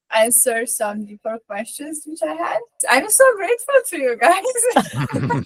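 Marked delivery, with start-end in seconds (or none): 2.9-5.4 high, laughing thanks; laughing gratitude. Real, not acted. Participant thanking the group for answers, laughing with gratitude. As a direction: let grateful laughter lift the voice.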